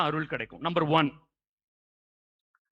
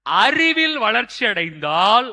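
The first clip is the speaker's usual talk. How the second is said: slow, loud, higher proclamation; forceful preaching; 5 semitones higher, 9 dB louder, slower than usual. Real, not acted. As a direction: drive the teaching home phrase by phrase. (முழங்கும் பிரசங்கம்)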